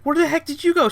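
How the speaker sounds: high, loud indignant question; startled demand. Retold or imitated. Dazed man waking up and demanding where his friend went. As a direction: burst out in shock.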